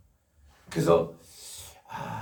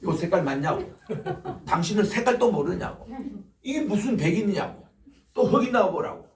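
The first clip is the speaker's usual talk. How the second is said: retold scolding; 5 semitones higher, 4 dB louder than usual. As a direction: scold them hard, as you did back then. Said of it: "강하게 배인들을 야단쳤습니다". (백인들을 꾸짖는 설교자의 큰 소리 야단)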